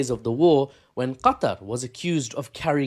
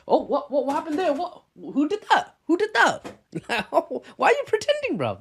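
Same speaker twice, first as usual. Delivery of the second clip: mock innocent taunt; 13 semitones higher than usual. Mocking Emiratis taunting Israel.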